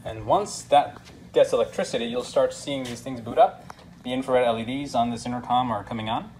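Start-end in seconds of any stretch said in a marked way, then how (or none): none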